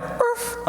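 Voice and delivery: tiny high, breath-light "urf"; imitated dog bark. Dog reacting to a jogging neighbor, small high bark. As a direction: give a little squeaky dog yip. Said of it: "my dog went".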